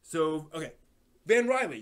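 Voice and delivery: ordinary talk, nothing marked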